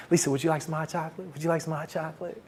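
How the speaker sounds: quiet, quick, tentative invitation; soft coaxing offer. Retold or imitated. Suitor offering her hot chocolate outside class, soft and coaxing. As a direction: offer it softly, almost under your breath. Timidly offering drink to wary woman.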